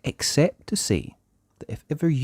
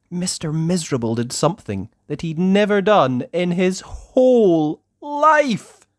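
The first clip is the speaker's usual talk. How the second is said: building excitement; 7 semitones higher, 4 dB louder than usual. A narrator's reading. Building to big moment.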